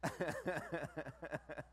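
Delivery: long burst of rapid laughter; hearty laugh. Real, not acted. Speaker amused with the audience, laughing heartily.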